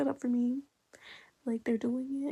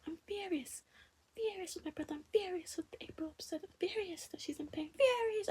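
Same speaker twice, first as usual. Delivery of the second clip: higher, softer mock British read; 7 semitones higher, 5 dB quieter than usual. Reading James's lines aloud in a mock British voice. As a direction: whisper it in a put-on British lilt.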